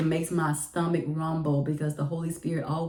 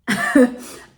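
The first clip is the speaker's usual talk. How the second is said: loud laugh; 7 semitones higher, 10 dB louder than usual. Real, not acted. Laughing at making principal wait.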